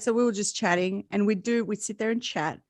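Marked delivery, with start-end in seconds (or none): none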